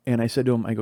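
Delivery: ordinary talk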